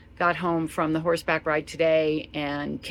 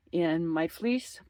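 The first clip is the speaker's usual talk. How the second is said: ordinary talk, nothing marked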